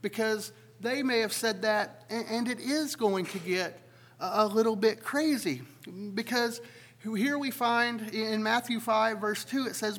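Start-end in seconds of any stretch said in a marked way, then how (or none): none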